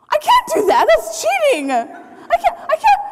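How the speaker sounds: very high, squeaky mock panic; hammed-up squealing objection; panicked protest. Acted protest at cheating.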